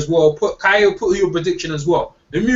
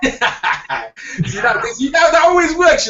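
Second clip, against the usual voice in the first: excited brag; 8 semitones higher, much slower than usual. Real, not acted. Laughing brag after a jab.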